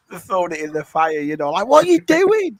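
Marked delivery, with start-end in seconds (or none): 1.7-2.6 mock furious shriek, much higher and louder; mum going mental. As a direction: shriek it like a mum whose kid burnt new trainers. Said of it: "someone's mum go mental".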